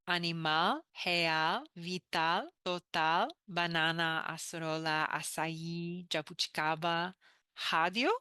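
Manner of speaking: learner's try at Portuguese, vowels full, rising word ends; careful Portuguese learner reading words; Portuguese pronunciation. Shown as a demonstration. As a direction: read the word list the Portuguese way. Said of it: "My understanding of the Portuguese pronunciation".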